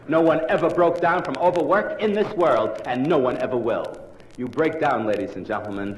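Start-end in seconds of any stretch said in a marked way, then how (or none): none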